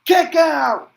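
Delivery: "kick out" yelled high and loud; excited call. Acted. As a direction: shout it as the kick-out lands. Calling a kick out.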